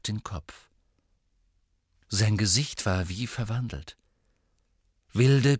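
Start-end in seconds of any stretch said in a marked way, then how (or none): none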